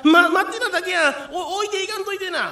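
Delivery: high, loud panicked plea; panicked pleading. Acted. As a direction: beg in panic not to be left. Left behind in the dark.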